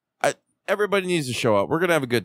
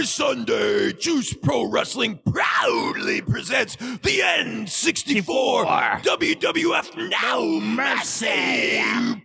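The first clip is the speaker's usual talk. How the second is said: much higher, shouted, slow promo announcer with reverb; monster truck hype announcer; 10 semitones higher, much slower than usual. Shown as a demonstration. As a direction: roar it like a Sunday monster truck ad. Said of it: "do the hype with a little reverb".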